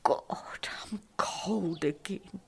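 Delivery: whispered, shivering. Real, not acted. Woman whispers that she is cold again. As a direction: breathe it out cold.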